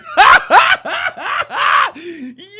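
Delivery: high howling laughter; loud shrieking laugh. Real, not acted. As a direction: lose it completely, howling with laughter. Cracking up at clip.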